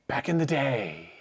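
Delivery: lightly voiced grumpy old man; a hint of a nostalgic old-timer. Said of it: "I'm gonna get a lot of old man [name]".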